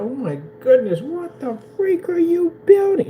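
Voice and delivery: high, slowing mock-astonished outburst; flipping out. Imagined onlooker reacting to the computer build, mock flip-out. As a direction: act floored, pitch climbing sharply. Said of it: "Did you just flip out".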